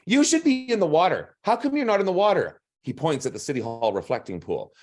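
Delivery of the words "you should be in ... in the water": put-on much higher, louder kid voice; high child voice, full of wonder; curious little boy. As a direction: pester with questions like a four-year-old.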